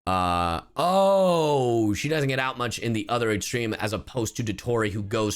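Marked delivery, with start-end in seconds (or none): none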